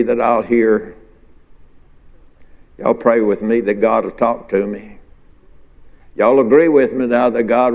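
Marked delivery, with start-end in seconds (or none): none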